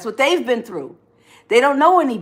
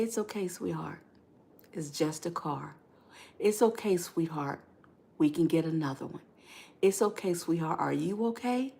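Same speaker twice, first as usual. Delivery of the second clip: soft and low, gently reassuring; loving partner reassuring; 4 semitones lower, 10 dB quieter than usual. Acted. Partner forgiving a wrecked car, soft tender reassurance. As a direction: murmur comfort to a guilty partner, sweetheart. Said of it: "they're gonna say".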